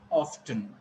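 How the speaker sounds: "often" as OFF-tun; "often" with a clear T after the F; American sounded T. Shown as a demonstration. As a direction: pronounce the T in "often". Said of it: "but in American English".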